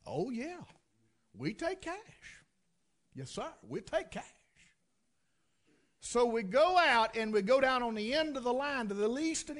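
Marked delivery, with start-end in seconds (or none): 0.1-4.2 soft, drawn-out salesman's assent; mock awed agreement. Salesman learning the customer pays cash, mock awe. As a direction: slowly savor the words, softly.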